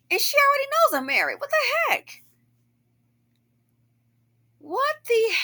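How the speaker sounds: very high-pitched, exasperated disbelief; incredulous. Real, not acted. Woman reacts to another woman's dirty looks, incredulous. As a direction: let the pitch shoot up, baffled and put out.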